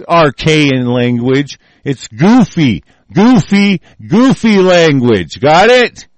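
slow, loud, sneering repeats; loud scornful rant. Real, not acted. Ranter scorning jargon to his audience, slow loud sneer. Mocking scientific language loudly.

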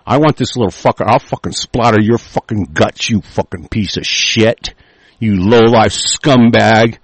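low, seething real anger; pissed off. Real, not acted. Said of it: "I'm talking like this and I'm fucking pissed".

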